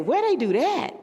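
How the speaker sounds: lightly quoting a puzzled police chief, high; imitating the police chief. Retelling the Dallas police chief's shock to the host, imitated high. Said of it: "I can't say it in his Puerto Rican accent".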